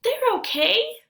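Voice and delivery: a kid pretending the shoes fit; boy's reply.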